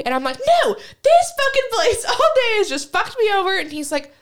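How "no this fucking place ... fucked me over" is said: high, loud rant through a laugh; retold furious outburst. Daughter ranting to dad about U-Haul, mock-furious and loud. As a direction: replay your blow-up, pitched way up and loud.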